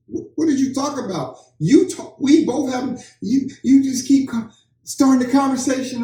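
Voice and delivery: high-pitched retold squabble, stumbling over starts; mock bickering. Blaming each other for the lost two hours, mock bickering. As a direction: act out a couple's accusing back-and-forth, pitched high. Retold back-and-forth blame between partners.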